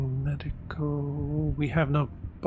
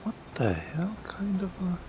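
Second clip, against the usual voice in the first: whispering; 3 semitones higher, 5 dB quieter than usual. Real, not acted. Puzzled at something on screen.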